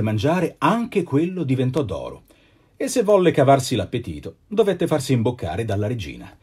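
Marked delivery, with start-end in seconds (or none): none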